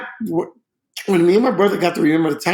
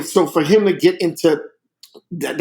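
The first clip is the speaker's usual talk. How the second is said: usual speaking voice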